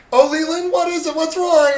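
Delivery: high-pitched mock fretting wife; worried wife impression. Wife asking her husband over the phone, imitated high worried voice. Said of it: "Leland's talking with Sarah".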